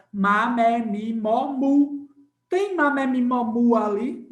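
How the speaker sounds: má, mé, mi, mó, mu sounded out loud and separate; sounding out syllables. Shown as a demonstration. Teacher drilling the class on the M syllables, sounded out loud. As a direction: chant má mé mi mó mu loudly, one by one. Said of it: "Qual é o som do M?".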